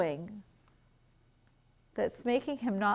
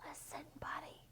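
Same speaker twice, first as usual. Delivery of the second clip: very soft, voiceless whisper; 6 semitones higher, 20 dB quieter than usual. A narrator's reading. Parents trying to get Buddy to listen, whispering. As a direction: whisper it slyly to get attention. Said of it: "They tried whispering".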